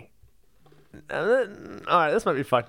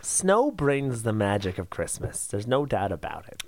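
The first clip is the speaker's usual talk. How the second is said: mock sincere; 5 semitones lower, 5 dB quieter than usual. Mock-sincere quoting lyric.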